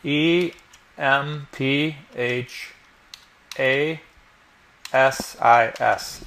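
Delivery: slow, letter by letter as you type; spelling it out. Shown as a demonstration. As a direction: spell each letter out slowly. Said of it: "we're gonna type out E".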